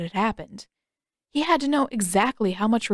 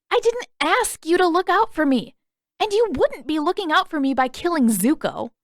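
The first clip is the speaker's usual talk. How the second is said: angry retort; 8 semitones higher, 3 dB louder than usual. Acted. Katara angrily arguing with Sokka.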